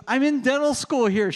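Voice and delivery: high, loud imitation of an eager student; excited fan. Dental student introduces herself to the speaker, excited.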